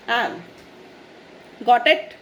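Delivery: normal talking voice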